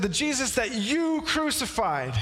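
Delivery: usual speaking voice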